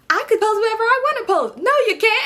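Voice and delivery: very high, loud, mocking squeak; mocking kid voice. Little sisters mocking her argument in a very high, loud squeak. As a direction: mimic her like a bratty sister.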